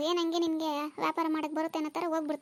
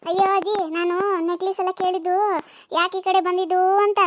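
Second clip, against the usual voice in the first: shouting; 8 dB louder than usual. Acted. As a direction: yell so the old woman hears. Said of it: "ಯಾಕೆ ಹಂಗ್ ಕಿರ್ಚ್ತೀಯ?".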